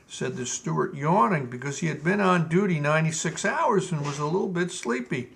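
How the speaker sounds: plain reading voice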